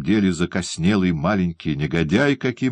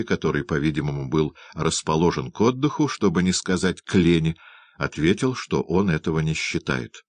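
ordinary delivery, nothing marked

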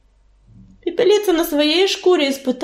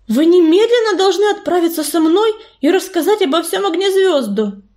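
higher-pitched, urgent insistence; 6 semitones higher than usual. A narrator's reading. Healer urging cats to report to their leader, urgently. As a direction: press them to act at once.

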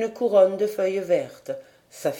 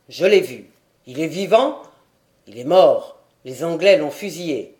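deep-voiced warrior reporting; 4 semitones lower, 6 dB louder than usual. A narrator's reading.

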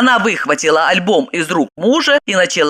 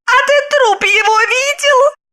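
much higher needling woman's voice; scornful question. Acted. Wife taunts husband, high scornful question. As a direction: goad him with it. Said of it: "спросила Загогулина".

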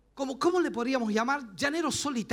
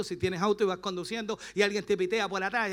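ordinary talk

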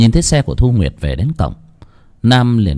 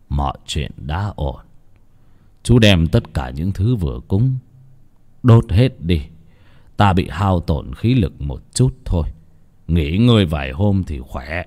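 low, soft, drained voice; weary old man; 4 semitones lower, 4 dB quieter than usual. Acted. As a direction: reassure softly with little strength left. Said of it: "mệt mỏi chìm vào giấc ngủ". (giọng ông cụ mệt mỏi, yếu ớt)

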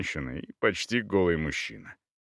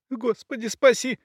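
high frightened plea, softer at the start; quieter, catching breath; 14 semitones higher than usual. A narrator's reading. Terrified woman pauses her screaming to pray, catching breath. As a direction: pray quickly between screams. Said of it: "тише, переводя дух".

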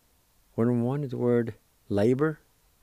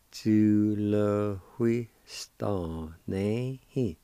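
very slow, each syllable separate; much slower than usual. Shown as a demonstration. Teacher slowly pronouncing a word for students. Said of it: "It's pronounced like this".

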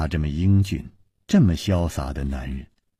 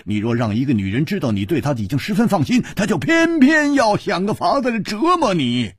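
much higher and louder, crowing with delight; triumphant booming hero; loud exclaiming; 6 dB louder than usual. Acted. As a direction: slap the table and announce it to everyone. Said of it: "大声说".

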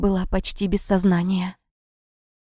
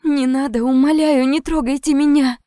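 higher, desperate begging; tearful pleading; 5 semitones higher, 4 dB louder than usual. A narrator's reading. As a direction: plead in fear through tears. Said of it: "сквозь ... слёзы взмолилась я".

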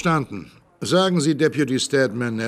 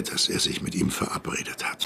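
whispered suspicion; 8 dB quieter than usual. Acted. Sheriff ahnt Verschwörung.

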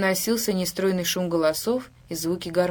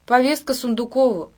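raised, higher voice, a mild shout; calling out; 5 semitones higher, 4 dB louder than usual. A narrator's reading. Kim to man at the gate, calling out. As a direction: call it out towards the house. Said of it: "крикнул Ким".

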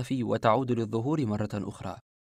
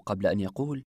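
ordinary delivery

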